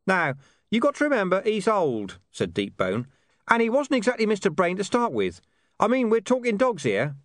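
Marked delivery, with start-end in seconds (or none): 0.1-2.2 high-pitched character voice. A narrator's reading. Hidden speaker makes excuses for an old dog, explanatory. 3.5-7.2 high-pitched character voice. A narrator's reading. Hidden speaker makes excuses for an old dog, explanatory.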